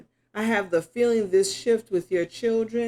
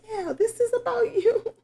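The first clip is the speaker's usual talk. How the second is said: excited. Real, not acted. Surprised reading a card.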